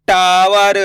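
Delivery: टावर slowly, long stressed टा; stretched first syllable. Shown as a demonstration. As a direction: sound out टावर with a drawn-out aa. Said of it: "टा पे जोर लगाएंगे". (टावर में टा खींचकर)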